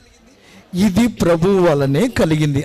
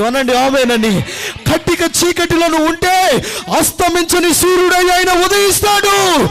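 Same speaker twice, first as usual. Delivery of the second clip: preaching at full force; 8 semitones higher than usual. Acted. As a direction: lift the congregation, preaching full out.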